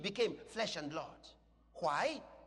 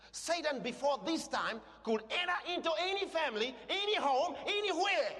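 high preacher's cry, climbing to the last word; full-force preaching; 9 semitones higher, 4 dB louder than usual. Real, not acted. Preacher warning what Satan could do, rising full-force preaching. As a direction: build louder and higher through the list.

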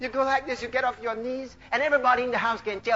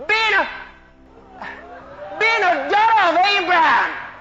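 preach; 4 semitones higher, 6 dB louder than usual. Acted. Preacher teaching women to speak to the mirror, full-force preaching.

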